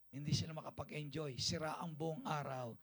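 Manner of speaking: ordinary talk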